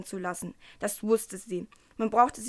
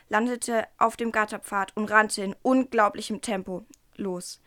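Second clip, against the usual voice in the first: plain reading voice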